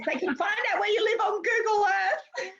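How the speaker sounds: high-pitched mock panic; hammed-up alarmed wail; [name] freaking out. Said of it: "[name] gets freaked out".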